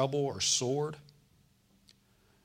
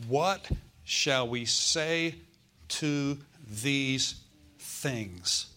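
slow scripture reading; much slower than usual. Acted. Preacher reading scripture slowly.

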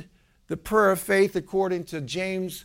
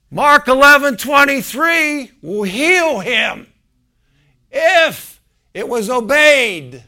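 slow thundering proclamation, much louder and higher; booming voice of the Lord; 8 semitones higher, 13 dB louder, slower than usual. Retold or imitated. Preacher booming God's word heard in the hospital. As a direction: boom it out like a voice from heaven. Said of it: "I heard the word of the Lord".

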